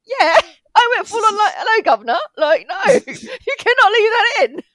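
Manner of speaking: high, loud mock outburst; hammed-up yelling with a laugh breaking in; exasperated yell. Retells actor's exasperated outburst, laughing.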